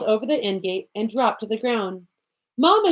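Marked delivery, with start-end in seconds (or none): 2.6-2.9 put-on high, loud child's cry; crying out loudly. A narrator's reading. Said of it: "she cried more loudly now".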